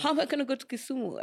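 high, rushed, dismayed question to mom; retold incredulous protest. Daughter protesting to mom about a job in Kisumu, incredulous. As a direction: blurt it out high and fast, reliving the disbelief.

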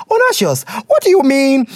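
what do you mean, thrown up high; shocked question; incredulous. Real, not acted.

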